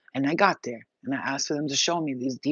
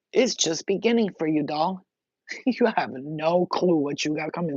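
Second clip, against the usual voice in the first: ordinary delivery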